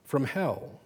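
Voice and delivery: mild tearful denial, a touch slow; understated tears, holding back. A narrator's reading. Ruby denies the girl's insult, mildly tearful. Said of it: "she said tearfully".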